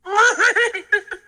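high squeaky giggle, fading; a tiny creature's tickled giggle; Yoda giggle. Acted.

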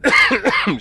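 coughing fit, choking; choking on a drink. Real, not acted. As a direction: choke and cough through the words. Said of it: "Sorry, I'm choking".

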